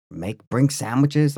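ordinary talk

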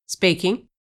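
a hint of gruff male voice; lightly voiced gruff man on the phone; mildly gruff phone answer. A narrator's reading. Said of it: "a gruff male voice answered".

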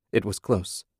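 usual speaking voice

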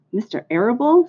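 lightly voiced tearful dismay; mild sob. A narrator's reading. Pig hears Fern's father is in plot, mild sob. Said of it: "sobbed Wilbur".

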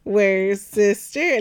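put-on slow, drawn-out little-kid questions; imitating toddler Gracie. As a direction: stretch each word like a toddler calling out. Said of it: "that's what she would say all the time".

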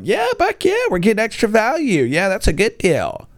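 high, excited mock parent; gleeful parents. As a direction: squeal like a parent who got a bargain. Parents voiced pleased.

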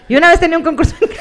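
giggling through the words; fast and laughing. Real, not acted. Retries the line while laughing.

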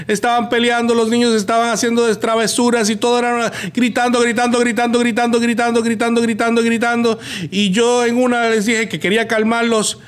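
ordinary delivery

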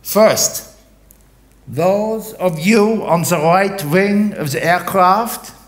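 captain in a boat addressing the stranded passengers; captain on a loudspeaker. Acted. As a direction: call out from the rubber boat through a loudspeaker. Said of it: "he has a loudspeaker".

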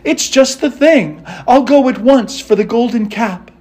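high, eager delight; joyful Dorothy. A narrator's reading. Dorothy seizing on the plan, high and joyful. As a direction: pitch it up, glad and ready to go. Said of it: "said Dorothy joyfully".

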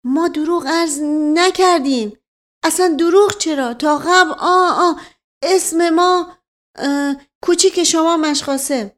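put-on high, stammering old man; flustered old servant. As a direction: stumble over his words, scared.

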